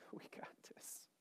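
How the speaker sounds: barely voiced, hushed reassurance; confident whisper. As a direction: whisper it as calm assurance. God's calm aside, acted.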